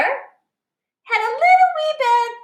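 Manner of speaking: high, small voice for the wee bear; little bear's high voice; tiny baby bear voice. A narrator's reading. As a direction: squeak it out like a tiny bear cub.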